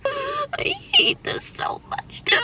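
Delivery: slow, high tearful wail; crying. Real, not acted. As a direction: sob the words out, overwhelmed. Crying over car trouble.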